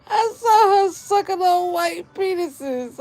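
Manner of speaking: high, laughing disbelief; barely holding in the laugh. Real, not acted. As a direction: squeal it through laughter. Recounting the video, cracking up.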